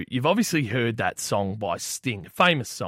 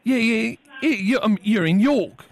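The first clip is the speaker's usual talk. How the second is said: higher-pitched fake English caller; poor English accent; 9 semitones higher, 3 dB louder than usual. Prank caller insists cafe is in York, poor English accent. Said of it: "disregard the piss poor English accent".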